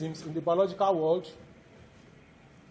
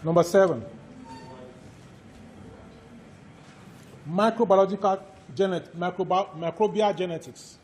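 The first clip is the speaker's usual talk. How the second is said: dictation. Acted. Lecturer dictates course outline.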